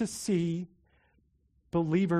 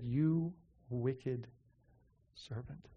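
lower, much softer condemning king; stern king; 6 semitones lower, 11 dB quieter, much slower than usual. Retold or imitated. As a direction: drop low and grave, like a king passing judgement.

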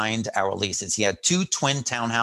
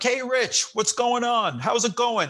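high, lightly voiced cheery greeting; a hint of the big friendly Irish handyman; big Irish guy; 11 semitones higher, slower than usual. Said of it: "He's like".

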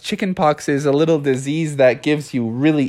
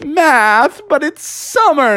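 high, incredulous complaint; dismayed protest; 13 semitones higher, 4 dB louder, slower than usual. Acted. Frog handed a math workbook in summer, high dismayed protest. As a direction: object in a high, whiny voice.

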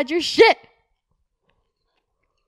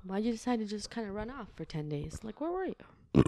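friendly cop, much softer and chummy; soft good cop; 3 semitones lower, 10 dB quieter than usual. Acted. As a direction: win him over gently like a buddy.

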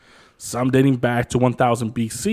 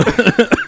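laugh; 9 semitones higher, 6 dB louder than usual. Real, not acted. Host laughs before answer.

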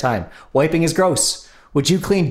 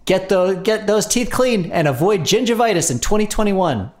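ad read; 5 semitones higher than usual. Acted. Hammy ad read for sponsor.

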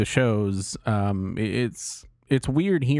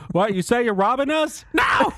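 high squeaky voice jumping to a shrill, louder "No!"; mock high voice; 13 semitones higher, 4 dB louder than usual. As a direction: play a startled shopkeeper for laughs. Plays the shopkeeper in the skit.

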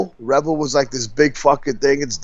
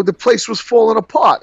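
higher-pitched New York impression; bad New York attitude; 7 semitones higher than usual. Candidate explains pulling out of Atlantic City, in imitated New York attitude. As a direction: imitate a dismissive New Yorker's excuse. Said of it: "he did a bad New York thing".

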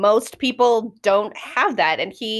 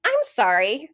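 Murphy scolding; 11 semitones higher than usual. Retold or imitated. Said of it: "and Murphy's like".

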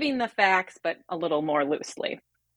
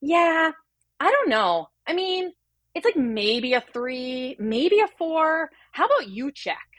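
high, hesitant, slow mock of a clueless resident; wavering up high like she has no idea; high, dithering impression of the unsure doctor; 7 semitones higher, slower than usual. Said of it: "she goes".

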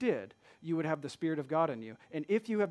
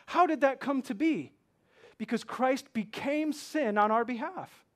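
rising, higher and louder with mounting wonder; preaching at full force; 6 semitones higher, 6 dB louder than usual. Acted. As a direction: build the amazement toward the pulpit's peak.

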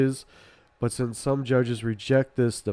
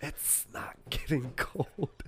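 slow hushed whisper; 12 dB quieter than usual. Real, not acted. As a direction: breathe it slowly, unvoiced. Whispered reply.

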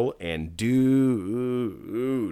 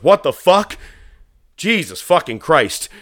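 loud, high outburst; fed-up wrestler; 8 semitones higher, 9 dB louder than usual. Retold or imitated. Wrestler finally gets the working mic, loud exasperated swearing. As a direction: curse loudly into the mic, higher pitched. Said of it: "gets the right mic and he goes".

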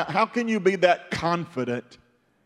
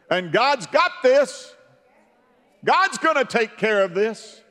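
preaching Daniel; 6 semitones higher than usual. Retold or imitated. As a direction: proclaim it with rising fire. Daniel voiced reassuring king.

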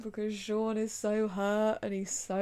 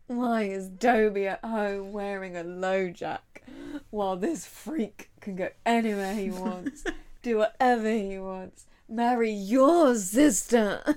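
put-on loud drunken rant; drunk Emily; 7 dB louder than usual. Emily ranting about the murderer, drunk and getting louder. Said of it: "they immediately realise that she's drunk".

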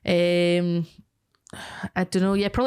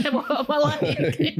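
higher, slow, giggling repeat; laughing echo; 7 semitones higher, much slower than usual. Retold or imitated. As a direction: savour the line while laughing. Echoing the sheepish line laughing.

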